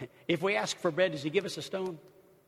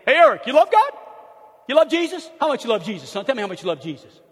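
loud, high booming Italian father quizzing his son; the father asks his boy if he loves Jesus, booming; Italian dad; 7 semitones higher, 13 dB louder, faster than usual. Retold or imitated. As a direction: belt it out like an eager Italian dad. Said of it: "I grew up with my dad saying".

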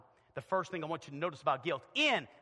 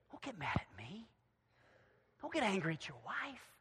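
soft, near-whispered counsel; hushed admonition; 5 dB quieter than usual. Acted. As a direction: drop to a confidential hush. Confides after the shouted rebuke.

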